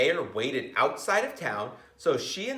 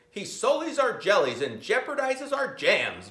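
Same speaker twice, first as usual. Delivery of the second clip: boiling with anger; 7 semitones higher, 3 dB louder than usual. Acted. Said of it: "boiled the marmalade maker".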